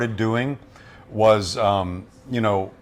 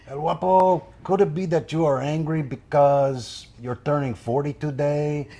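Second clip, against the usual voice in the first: put-on higher, timid Jefe voice with a Mexican accent; Jefe impression; 5 semitones higher than usual. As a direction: nervously ask El Guapo as Jefe. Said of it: "and Jefe says".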